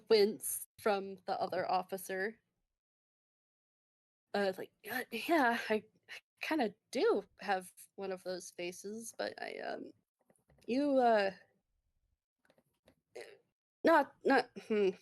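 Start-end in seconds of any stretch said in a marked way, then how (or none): 4.9-14.9 halting, awkward false starts with pauses; fumbling over words. Acted. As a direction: stumble and trail off, searching for words. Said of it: "He kind of fumbles over his words".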